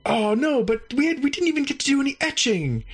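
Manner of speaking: a let-down host complaining; whiny alien host. Retold or imitated.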